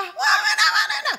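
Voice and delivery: high-pitched shouted gibberish; loud, hammed-up screaming squabble; mock yelling. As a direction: shriek like a married nagger. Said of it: "bisa teriak-teriak".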